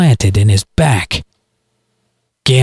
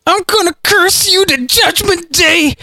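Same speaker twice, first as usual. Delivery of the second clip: hillbilly cursing. A narrator's reading. Dying hillbilly curses Galen.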